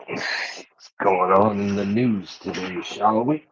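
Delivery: ordinary delivery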